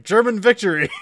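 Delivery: loud hammed-up proclamation; mock shout. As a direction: shout it like a triumphant announcer. Mock Nazi announcement.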